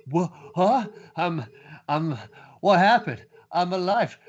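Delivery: high, halting, bewildered waking voice; high stop-start daze of someone just revived; dazed confusion. Acted. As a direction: come to, not knowing where you are.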